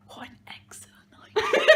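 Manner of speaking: laughing through a whisper; real laughing whisper. Real, not acted. Giggling while hiding the book.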